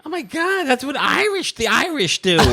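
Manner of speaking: high, mock-shocked New Jersey mom; mom's New Jersey accent. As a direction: exclaim like a Jersey mother scandalized by the Irish. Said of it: "your mom says what".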